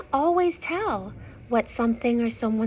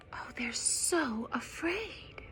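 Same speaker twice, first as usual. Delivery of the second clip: whisper; 2 semitones higher, 7 dB quieter than usual. Real, not acted. Aside to little readers about the scared bugs, whispered. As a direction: whisper it like a secret. Hushed aside to young listeners.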